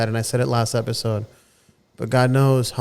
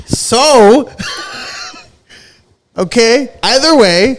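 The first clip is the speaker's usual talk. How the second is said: high, loud laughing brush-off; deflated laugh; 13 semitones higher, 9 dB louder, much slower than usual. Real, not acted. Shrugging off the age gap with a loud laugh. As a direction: laugh it off after the age reveal. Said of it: "Why'd you have to do that?".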